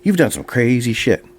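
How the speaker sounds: lightly voiced eager fan asking questions; eager bar guy. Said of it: "He says".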